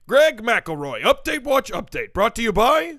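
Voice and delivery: loud, high jingle echo; mock sponsor announcer. Co-host echoing the segment's sign-off, mock announcer. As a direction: blast out the title and sponsor lead-in.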